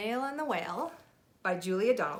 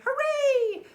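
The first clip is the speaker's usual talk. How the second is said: much higher, louder cheer; cheering shout; 10 dB louder than usual. Acted. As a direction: villagers cheering the whale home. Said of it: "villagers shouting".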